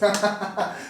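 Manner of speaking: big loud laugh. Real, not acted. Laughing because they had just talked Asics for an hour. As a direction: laugh out as if caught out.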